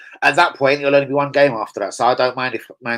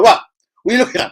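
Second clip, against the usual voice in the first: hammed-up high, louder mock challenge; mock aggressive fan; 10 semitones higher, 8 dB louder, faster than usual. Retelling the fan he stared at, mock indignant. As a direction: squeak it out like a startled fan. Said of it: "He's like".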